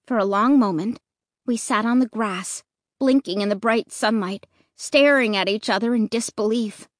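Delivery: usual speaking voice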